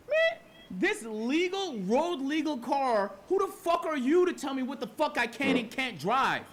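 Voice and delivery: venting to chat about being told his car belongs on the track, angry; furious rant. Real, not acted. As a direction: tear into the cop's remark. Furious about cop's comment.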